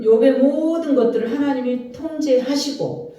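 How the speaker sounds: ordinary delivery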